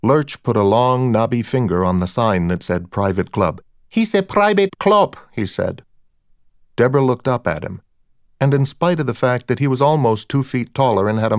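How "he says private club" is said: put-on high-pitched and thick with a Cuban accent. A narrator's reading. Doorman refusing entry at the club, high thick Cuban accent. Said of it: "a high voice with a thick Cuban accent".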